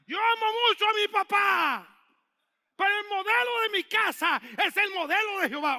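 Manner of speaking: loud, high preaching shout; fervent preaching. Acted. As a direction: preach it out to the congregation. Preaching at full force. (predicación a gritos)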